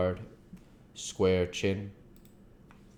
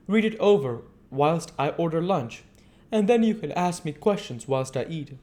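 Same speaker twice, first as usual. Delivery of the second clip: put-on higher, louder voice for a polite young woman; polite young wife; 10 semitones higher, 5 dB louder than usual. Mina to the doctor, offering her hand, polite and gracious. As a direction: answer with gracious warmth.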